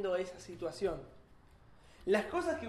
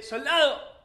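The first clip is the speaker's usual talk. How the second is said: loud military summons; commanding call; 5 semitones higher, 9 dB louder, slower than usual. Acted. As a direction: call the soldier like a tsar. The tsar calls his soldier. (el zar llama a su soldado, con un grito de mando)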